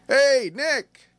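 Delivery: high-pitched hearty group hail; hearty greeting. A narrator's reading. Friends greeting Nick as he comes in, hearty. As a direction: call out a big, cheery hello. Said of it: "His friends greeted him with a hearty".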